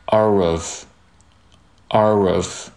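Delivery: "are of" slowly joined, r gliding into "of"; linked pronunciation. Shown as a demonstration. Teacher showing how are and of link, slow linked pronunciation. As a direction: draw out "are of" as one linked sound. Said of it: "are和of连读".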